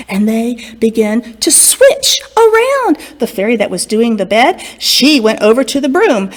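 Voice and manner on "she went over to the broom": usual speaking voice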